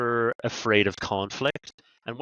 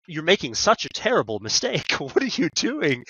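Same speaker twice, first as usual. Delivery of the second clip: higher, incredulous disbelief with a laugh; exasperated inner thought; 6 semitones higher than usual. Retold or imitated. Buyer silently watching a salesperson's mistake, exasperated and higher. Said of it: "because you're like".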